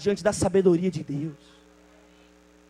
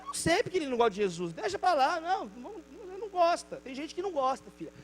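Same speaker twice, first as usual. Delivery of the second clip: a mother waving it off, quick and softer; dismissive mother; 4 semitones higher, 6 dB quieter than usual. Retold or imitated. Mother brushing off daughter's question, softer dismissive voice. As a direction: brush the question aside gently.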